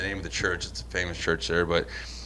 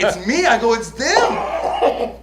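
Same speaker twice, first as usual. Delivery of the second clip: higher, louder, laughing through it; much higher, laughing indignation; laughing protest; 12 semitones higher, 7 dB louder, much slower than usual. Retold or imitated. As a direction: laugh while pleading your innocence. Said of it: "I'm like".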